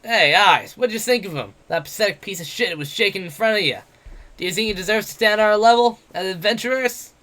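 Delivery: brash, jeering tavern boaster; cocky werewolf adventurer. Acted. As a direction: taunt him like a swaggering bully. Bete voiced in the story.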